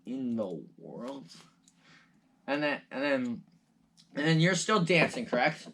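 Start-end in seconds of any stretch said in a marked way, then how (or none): 0.1-1.3 baffled. Acted. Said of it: "They, they say".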